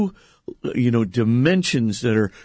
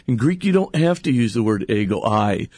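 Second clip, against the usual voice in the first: ordinary talk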